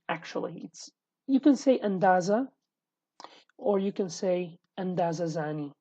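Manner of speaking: ordinary delivery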